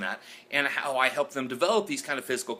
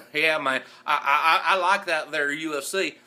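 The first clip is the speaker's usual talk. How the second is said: put-on Southern accent, laughing; 4 semitones higher, 4 dB louder than usual. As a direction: chuckle through it like a country fight fan. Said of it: "when I use an accent".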